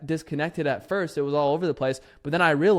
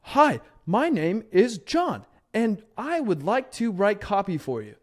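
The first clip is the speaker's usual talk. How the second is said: slow, higher stiff script read; robotic script reading; 5 semitones higher, slower than usual. Retold or imitated. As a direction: read it bar for bar like a bot. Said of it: "They're like".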